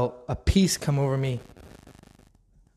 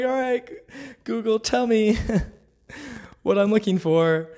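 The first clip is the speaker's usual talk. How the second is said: high, laughing plea to Google; laughing self-mockery; 7 semitones higher than usual. Laughing as she asks Google for the verse. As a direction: laugh through it like asking a search engine for help.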